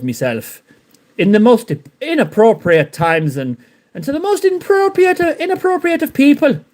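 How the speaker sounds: mock Frankie bragging gleefully; Frankie impression. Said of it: "I'm gonna do my Frankie impression now".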